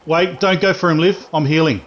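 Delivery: urgent shout. Real, not acted. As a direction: stop your teammate urgently. Warning teammate mid-heal.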